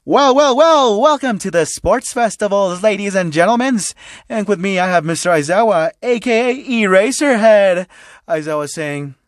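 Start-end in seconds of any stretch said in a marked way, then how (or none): 0.0-7.9 loud announcer. Acted. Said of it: "President Mike would be announcing".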